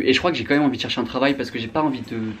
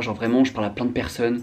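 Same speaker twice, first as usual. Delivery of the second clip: ordinary talk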